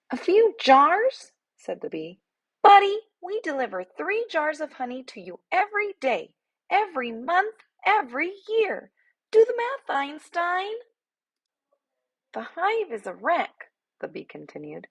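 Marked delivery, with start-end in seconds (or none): 0.1-1.3 high, loud, slow incredulous bee; scoffing tiny bee. A narrator's reading. 2.6-10.7 much higher sarcastic little bee voice; a small bee talking down to a bear; sassy tiny bee. A narrator's reading. 12.3-13.7 much higher sarcastic little bee voice; a small bee talking down to a bear; sassy tiny bee. A narrator's reading. Bee complains.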